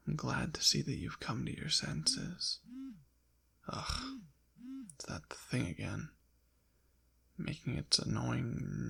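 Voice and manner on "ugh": short, high, soft "ugh"; small exasperated grunt, pitched up; annoyed groan. Acted. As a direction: give a quick groan of distaste. Annoyed by the buzzing phone.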